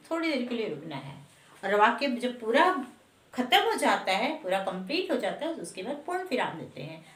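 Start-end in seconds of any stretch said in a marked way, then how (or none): none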